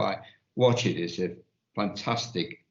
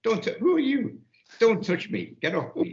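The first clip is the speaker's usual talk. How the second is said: imitating her, pitched much higher; hammed-up recoil, voice up high; mock indignant protest; 11 semitones higher than usual. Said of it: "she wants you to touch".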